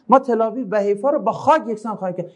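declamatory, emphatic statement of intent; firm threat. Shown as a demonstration. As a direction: deliver it as a resolute warning. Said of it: "این جمله با چه معنی باید بگیم؟".

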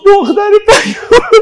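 high, tearful cry of mourning; wailing lament. Real, not acted. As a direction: call out in grief, voice rising. Mourning eulogy, calling the dead Fatima.

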